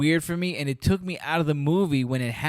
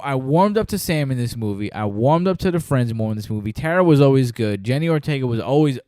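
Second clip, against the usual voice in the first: usual speaking voice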